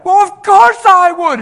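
loud, high, drawn-out mock yell; imitated shrieking comeback; aggressive retort. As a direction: fire it back in exaggerated indignation. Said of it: "she said it aggressively".

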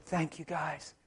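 soft, gentle thanks; real gentle. Retold or imitated. Retelling his thanks to the cops, real gentle. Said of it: "I looked at him real gentle".

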